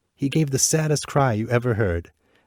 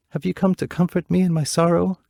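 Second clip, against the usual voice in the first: higher, gentle princess voice; tender princess; 9 semitones higher, 4 dB louder than usual. Acted. Princess to the bluebird at her window, gentle and higher. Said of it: "stroking his feathers".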